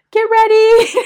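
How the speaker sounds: very high, loud command; mom's shout. Retold or imitated. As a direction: shout it through the pain. Mom in labour commanding daughter.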